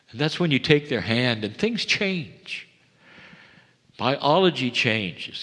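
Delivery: ordinary talk, nothing marked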